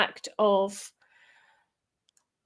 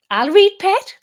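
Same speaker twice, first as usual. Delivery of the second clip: broad Geordie, pitched high and loud; Geordie accent; 10 semitones higher, 10 dB louder than usual. Pretend. Speaker greets a Newcastle listener in a Geordie accent. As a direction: put on a cheeky Newcastle voice. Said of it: "was my Geordie accent".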